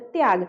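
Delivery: त्याग said tyaag with the t run quickly into ya; त्याग as one quick syllable with no vowel after t; half t joined. Shown as a demonstration. Teacher repeating त्याग, t rushed into ya. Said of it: "झट से अगला वर्ण हमको बोलना पड़ता है".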